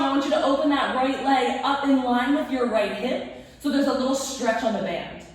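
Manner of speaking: ordinary talk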